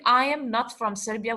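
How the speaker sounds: normal talking voice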